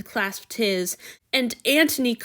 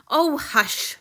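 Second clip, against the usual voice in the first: ordinary delivery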